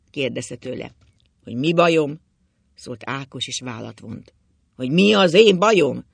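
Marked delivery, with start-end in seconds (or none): 1.4-2.2 loud echoed question; indignant retort. A narrator's reading. Drunk husband answering his worried wife, loudly indignant. 4.8-6.0 much louder, higher retort; heated indignation. A narrator's reading. Drunk husband challenging his wife, shouting indignantly.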